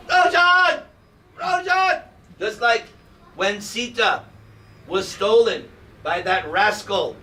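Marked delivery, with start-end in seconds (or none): 0.1-2.0 much higher, desperate calling out a name; frantic calling. Pretend. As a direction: call for him as if he is lost. Wife calling missing husband.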